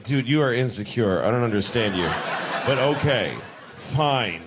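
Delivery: low, laid-back bro reply. Retold or imitated. Abraham answering God, low and laid back. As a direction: drop low and shrug it off. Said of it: "Abraham's like".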